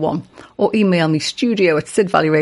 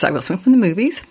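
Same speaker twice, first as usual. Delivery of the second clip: ordinary delivery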